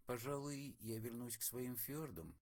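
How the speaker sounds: put-on soft, deflated old man voice; disappointed old man. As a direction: let him sag, disappointed. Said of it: "несколько разочарованно".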